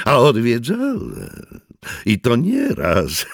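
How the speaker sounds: amused stranger admits it, laughing. A narrator's reading. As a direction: call it out still laughing, slowing down. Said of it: "zawołał ze śmiechem".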